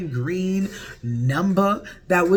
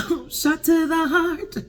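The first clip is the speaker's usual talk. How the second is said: higher, slow wounded cry; higher, slow mock heartbreak; dramatic wince; 12 semitones higher, slower than usual. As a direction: clutch your chest and drag it out. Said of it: "And I'm like".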